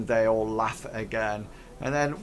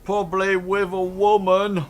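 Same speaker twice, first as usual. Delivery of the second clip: raised, loud ruffian jibe; shouted heckle; 7 semitones higher, 6 dB louder than usual. Acted. Ruffian answering where Hank is, raised shouted heckle. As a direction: call it over the crowd. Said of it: "one of them shouts".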